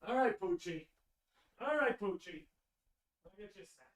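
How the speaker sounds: calling the dog from off-mic, faint and distant. Real, not acted. Streamer calling his dog from the next room, distant. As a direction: far off, calling a pet into the room.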